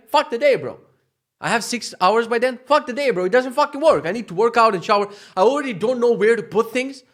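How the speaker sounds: high, loud, fed-up venting; exasperated tirade; frustrated rant. Real, not acted. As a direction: rant it out at full steam. Venting about ruined day.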